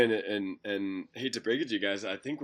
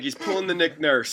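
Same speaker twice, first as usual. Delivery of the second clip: a second, calmer go at the French Canadian coach voice; mock French Canadian accent; 5 semitones higher, 5 dB louder than usual. Rival coach repeating his jab, mild French Canadian accent. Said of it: "That's my French Canadian accent".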